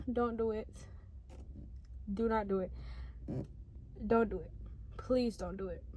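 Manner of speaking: quiet, earnest plea, voice dropped low; begging them gently not to do it; soft pleading. Real, not acted. To viewers considering suicide, a soft pleading.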